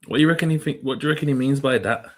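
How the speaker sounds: ordinary delivery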